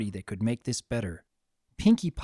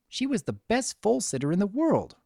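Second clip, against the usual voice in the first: plain reading voice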